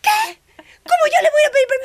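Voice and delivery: high, loud cry of protest; a near-sobbing, very high and loud indignant cry; outraged disbelief. Retold or imitated. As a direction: shriek it back as if the idea were absurd. Retells outraged reply to God.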